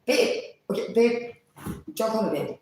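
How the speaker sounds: high-pitched retold rebuff; mock exasperation. Acting out his phone rebuff.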